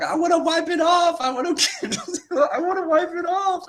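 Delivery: put-on high kid pleading with a laugh; imitating upset child. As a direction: squeak it out like a scared crying kid. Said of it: "Cool was actually crying".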